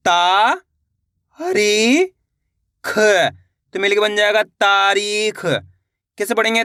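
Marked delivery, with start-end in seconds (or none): 0.1-3.0 isolated, held sounds with long pauses; teacher slowly spelling out by sound; syllable by syllable. Shown as a demonstration. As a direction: sound out each syllable separately for learners. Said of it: "एक अक्षर का उच्चारण करके".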